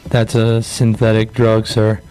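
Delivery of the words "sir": normal talking voice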